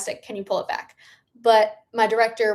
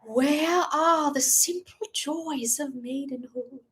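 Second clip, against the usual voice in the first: put-on Julie Andrews British accent; 4 semitones higher, 3 dB quieter, slower than usual. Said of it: "without a British accent".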